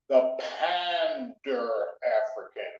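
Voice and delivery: "Pander" drawn out slowly and stressed. Shown as a demonstration. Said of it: "Not Pan-African, there's a difference".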